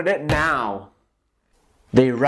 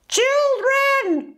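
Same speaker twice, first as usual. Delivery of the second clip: screeching principal. A narrator's reading. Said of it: "she screeched".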